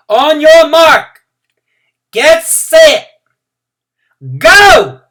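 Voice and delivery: very high, loud race-start shout; loudest shout. Acted. Teacher shouting the start in her loudest voice. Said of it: "shouted in her loudest voice".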